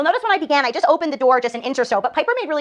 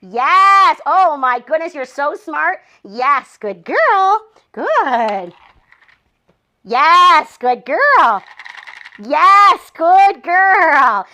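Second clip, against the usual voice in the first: loud, high, gushing praise; shower the puppy with delighted praise; over-the-top happy praise, louder; excited puppy praise; 3 semitones higher, 6 dB louder, much slower than usual. Real, not acted.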